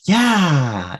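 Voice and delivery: high, loud, drawn-out "Yeah"; child's eager yes. Retold or imitated. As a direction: cheer it like a child offered ice cream. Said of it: "otherwise she will say".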